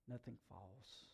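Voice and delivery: quiet, reflective repeat of Nothing false; soft echo. Shown as a demonstration.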